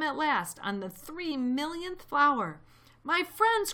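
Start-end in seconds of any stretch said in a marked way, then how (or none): 3.0-3.7 high, loud, urgent call; crying out. A narrator's reading. Elephant to his lost friends, crying out. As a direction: cry out to your friends. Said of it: "cried the elephant".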